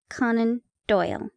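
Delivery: plain reading voice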